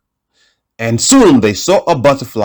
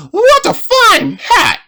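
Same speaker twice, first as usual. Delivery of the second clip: very high, slow little pig voice; squeaky pig voice. Acted. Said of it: "the pig said".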